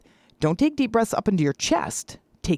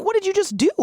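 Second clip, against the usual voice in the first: surprised; 13 semitones higher, faster than usual. Retold or imitated. Wife amazed on the phone.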